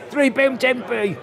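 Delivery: normal talking voice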